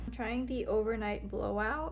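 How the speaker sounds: normal talking voice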